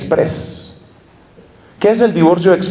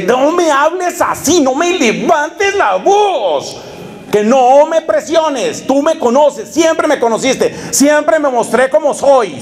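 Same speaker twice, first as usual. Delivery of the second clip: higher, slowed mock-bossy man; hammed-up bully telling her to shut up; mock arrogant boyfriend; 7 semitones higher than usual. Boyfriend scolding his girlfriend, hammed-up and high.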